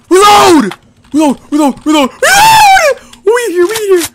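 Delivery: urgent yell. Real, not acted. Urging teammate mid firefight.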